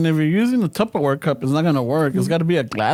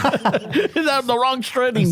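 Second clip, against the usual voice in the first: laughing; 6 semitones higher, much slower than usual. Real, not acted.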